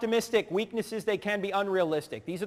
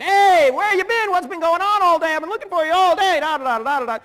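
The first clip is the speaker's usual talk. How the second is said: loud, high, gushing phone message; chatty high-I friend; 10 semitones higher, 8 dB louder than usual. Acted. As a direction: babble excitedly onto an answering machine. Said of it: "you'd be a high eye friend".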